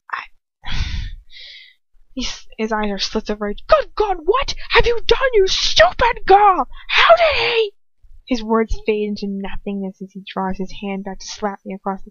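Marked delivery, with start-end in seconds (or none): 3.7-7.7 raging. A narrator's reading. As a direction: tear into the stupid girl. Lucius enraged before slapping her.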